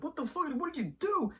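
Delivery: can't believe what he just did; shocked disbelief. Retold or imitated. Monica confronting Hayward about the missile, shocked and high. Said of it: "Monica's like".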